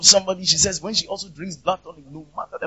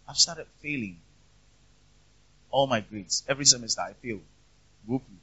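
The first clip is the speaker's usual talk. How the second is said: softer, lower, a touch of sorrow; sad retelling; 7 semitones lower, 9 dB quieter than usual. Retold or imitated. Preacher retelling a failing student's words, mild sadness. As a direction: lightly voice a student's quiet despair. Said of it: "sad story".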